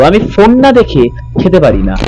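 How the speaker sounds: ordinary delivery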